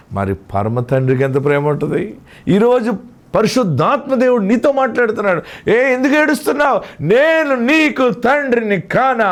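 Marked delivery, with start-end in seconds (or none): 2.5-7.0 high, ringing sermon cry; fervent preacher in full cry; impassioned preaching. Acted. Preacher at raised pitch. 7.0-9.3 high, slow, drawn-out cry; ringing rhetorical question at sermon climax; impassioned preaching. Acted. Same verse cried out as climax.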